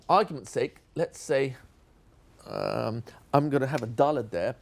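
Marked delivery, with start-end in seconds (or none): none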